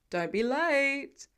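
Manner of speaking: slow call to someone leaving; calling after. A narrator's reading. Tony calls after Peter leaving in the elevator, calling out. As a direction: call it after him, drawn out. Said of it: "Tony calls after him".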